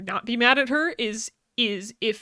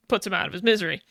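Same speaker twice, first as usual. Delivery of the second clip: usual speaking voice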